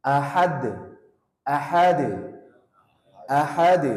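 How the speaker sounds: Ahad with the final d bounced; qalqalah bounce. Shown as a demonstration. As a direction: rebound the d of Ahad.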